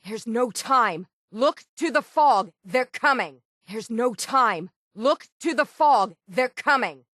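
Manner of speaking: urgent warning. Acted. Urgent warning of enemies in fog.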